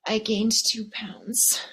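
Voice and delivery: slow gasp then a whispered confession; mock fret. Coach mimics a pretty friend whispering about her weight. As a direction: breathe the last words out as a whisper. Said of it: "and she's like".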